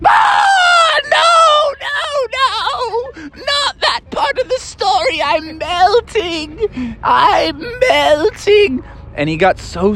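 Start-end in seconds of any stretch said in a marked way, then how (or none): 0.1-5.2 high shrieking panic; panicked squeal. Acted. 5.2-8.8 high, slow crying wail; hammed-up melting wail. Bubby melting dramatically.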